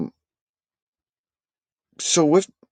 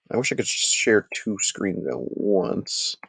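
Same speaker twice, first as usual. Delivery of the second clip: usual speaking voice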